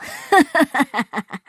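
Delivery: high gloating laugh falling away; smug laugh. Acted. Guan laughing smugly at his human donkey. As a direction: laugh in triumph. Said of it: "关老爷嘲弄阿凡提".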